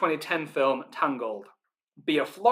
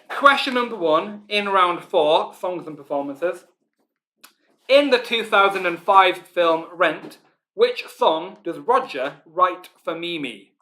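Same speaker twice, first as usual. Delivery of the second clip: quiz host announcing; 3 semitones higher, 4 dB louder, slower than usual. Acted. Quiz host reading question.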